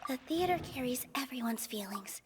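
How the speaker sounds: steady yourself under your breath; calming herself. Real, not acted. [name] reminding herself to keep calm, hushed whisper.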